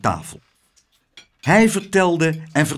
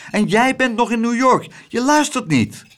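higher, louder reproach; indignant; 9 semitones higher, 5 dB louder than usual. Acted. Boy complaining his mother isn't listening, indignant. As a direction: tell her off for not listening. Son scolds inattentive mother.

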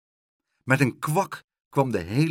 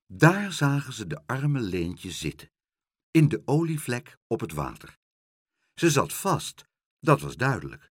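ordinary delivery, nothing marked

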